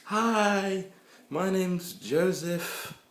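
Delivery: high, slow mock greeting; mock girly voice. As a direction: introduce yourself in a silly high voice. Mock stranger waving in the street.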